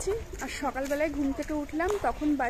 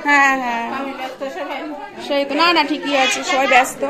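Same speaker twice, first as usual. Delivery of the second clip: loud reply; 10 dB louder than usual. Real, not acted. Answering grandma loudly.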